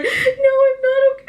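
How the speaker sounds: high-pitched, loud tearful wail; imitated crying. Wife sobbing to husband who asked if she's okay, mock crying. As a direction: wail it as a hammed-up crying fit. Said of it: "I was crying, you guys".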